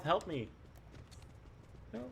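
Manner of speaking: normal talking voice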